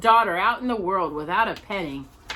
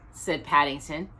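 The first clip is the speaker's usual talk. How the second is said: ordinary delivery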